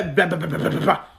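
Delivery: slow, low, mumbled blabla mocking gossip. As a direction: drag out the blabla to mock the chatter. Mocks people gossiping. (blabla moqueur, lent et grave)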